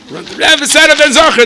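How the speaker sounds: high, loud, triumphant cry; excited shout. Acted. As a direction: shout the news as you run. Berish running, shouting the news.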